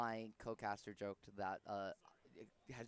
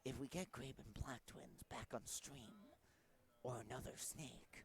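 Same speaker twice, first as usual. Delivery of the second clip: soft, slow Solid Snake voice; slow, quiet stealth soldier deal; Snake impression; 9 dB quieter than usual. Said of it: "talk like that the rest of the stream".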